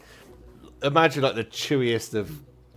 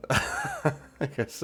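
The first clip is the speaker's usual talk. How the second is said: ordinary talk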